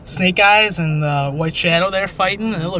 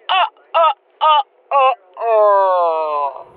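vampire laugh; much slower than usual. Pretend. Vampire count laugh after accent.

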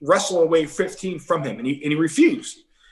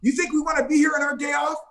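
much higher, mock shouting officer; imitated cop yelling; 9 semitones higher than usual. Police officers arresting the rookie in the clubhouse, high mock yell. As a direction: bark orders like an annoyed cop on his day off. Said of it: "they start yelling at him".

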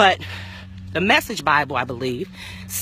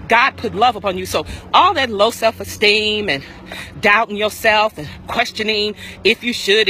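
preaching; 4 semitones higher than usual. Acted. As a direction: preach it with full force, rising. Preaching at full force.

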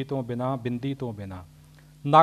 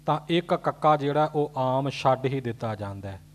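ordinary talk

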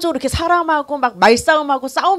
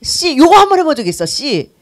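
high, loud burst of exasperation; mock real anger; 7 semitones higher, 8 dB louder than usual. Shown as a demonstration. Speaker showing her worst driving temper, mock anger. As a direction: blurt out a half-swallowed curse. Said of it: "정말 화날 때는".

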